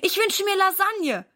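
high, eager wish; delighted. A narrator's reading. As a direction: blurt out your wish happily. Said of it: "freut sich Silas".

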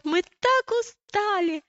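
high, drawn-out little-brother exclamation; acted childish cry of tiredness; exclaiming. A narrator's reading. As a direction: stretch it out high, like a worn-out child. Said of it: "воскликнул Иаков".

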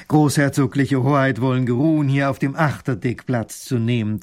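loud, formal ship captain bowing to royalty; ceremonious captain. A narrator's reading. Captain welcoming the little grand duke, loud and ceremonious. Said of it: "sagt der Kapitän".

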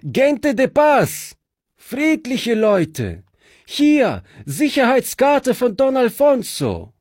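calling out. Acted. Fritz calls out to bandits.

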